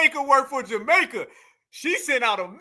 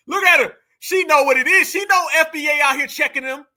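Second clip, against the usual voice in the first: louder and higher, cracking up at her; loud gleeful commentary; loud amused hype; 4 semitones higher, 6 dB louder than usual. Real, not acted. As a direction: hype it up like you can't believe it.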